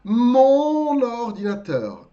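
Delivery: Mon ordinateur slow, mon linked to ordinateur; careful French. Shown as a demonstration. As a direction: say Mon ordinateur slowly with the liaison.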